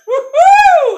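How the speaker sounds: high, loud takeoff whoop; whooping dragon. Acted. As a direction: let out a long, piercing woohoo. Dragon takes off flying.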